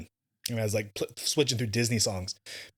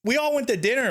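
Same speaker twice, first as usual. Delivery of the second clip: excited; 11 semitones higher than usual. Real, not acted. Excited retelling of dinner.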